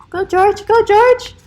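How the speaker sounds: root for George like a fan; cheering on. Real, not acted. Narrator cheering George toward the mail carrier, excited.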